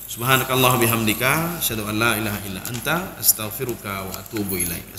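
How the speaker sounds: low, soft recitation of the closing prayer. Real, not acted. Preacher closes the sermon with a quiet Arabic prayer.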